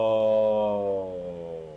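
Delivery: long drawn-out, sinking exclamation; stunned. Real, not acted. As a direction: stretch it out in stunned awe. Stunned by the baby reveal.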